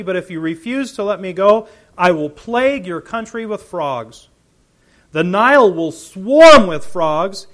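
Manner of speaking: loud, fast ringing reading of the Lord's words that fades at the end; preacher reading scripture, booming then fading. Acted. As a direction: proclaim it like a prophet. Reads scripture aloud.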